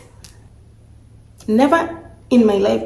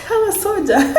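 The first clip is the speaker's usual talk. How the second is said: laughing joke; 12 semitones higher than usual. Real, not acted. Joking she did not cry.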